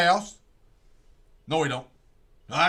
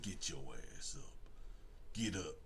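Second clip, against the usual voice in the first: low command; 11 semitones lower, 20 dB quieter than usual. Retold or imitated. Detective ordering villain up.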